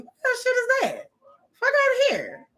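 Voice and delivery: outraged disbelief. Real, not acted.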